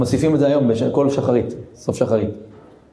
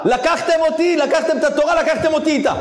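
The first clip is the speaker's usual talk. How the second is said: preaching shout; 12 semitones higher than usual. Acted. Preacher proclaiming God's words to the congregation, shouting high. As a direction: cry it out to the congregation.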